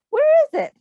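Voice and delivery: high, loud playful question; eager question. Real, not acted. Asking the children eagerly.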